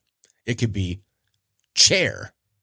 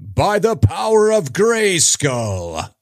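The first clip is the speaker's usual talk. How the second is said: high, slow, booming heroic proclamation; He-Man battle cry; 10 semitones higher, 4 dB louder, much slower than usual. Retold or imitated. Host imitating He-Man's transformation cry, high and slow. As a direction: raise the sword and declare it like a hero. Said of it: "good old He-Man".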